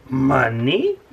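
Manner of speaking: hammed-up crab captain voice; a bad, hammy Mister Krabs. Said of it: "that was a terrible Mister Krabs impression".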